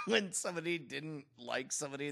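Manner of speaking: normal talking voice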